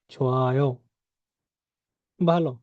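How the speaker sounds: normal talking voice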